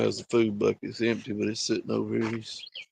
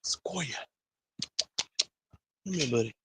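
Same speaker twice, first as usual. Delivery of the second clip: whisper; 3 semitones higher, 6 dB quieter than usual. Real, not acted. Softly calling the cat.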